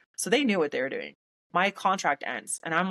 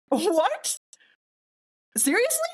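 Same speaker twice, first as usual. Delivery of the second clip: very high squeaky questions; appalled disbelief; slower than usual. Retold or imitated. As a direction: let each word shoot up in pitch, with a pause between. Stunned by victim-blaming question.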